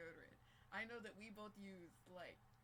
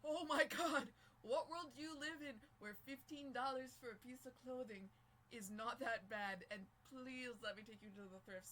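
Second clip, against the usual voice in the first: exasperated disbelief; 7 dB louder than usual. Retold or imitated. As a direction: blurt it out in disbelief. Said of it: "And I was like".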